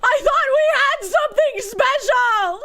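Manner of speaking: high, slow, hammed-up jilted lover; mock heartbreak. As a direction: wail it like a soap opera betrayal. Mock dramatic betrayal line.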